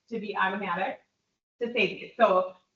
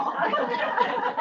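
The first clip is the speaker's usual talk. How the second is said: high laugh; 12 semitones higher than usual. Real, not acted. Joke about even number of people.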